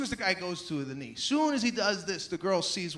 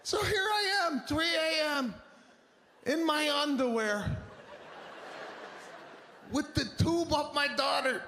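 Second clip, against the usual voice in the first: excited comic build; 7 semitones higher than usual. Real, not acted. Comic peak of the story.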